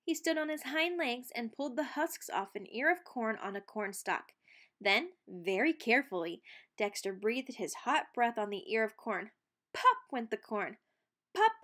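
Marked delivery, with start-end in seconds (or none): none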